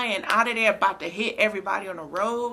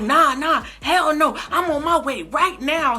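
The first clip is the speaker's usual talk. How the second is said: loud, high, heated refusal; furious insistence; 6 semitones higher, 6 dB louder than usual. Retold or imitated. Coworker on the phone heading to school, loud and furious. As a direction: shout it while already racing off. Coworker rushing to her child.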